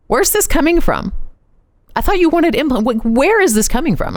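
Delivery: high, baffled husband asking where it came from; high, startled husband voice; taken aback. Retold or imitated. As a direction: play the stunned husband.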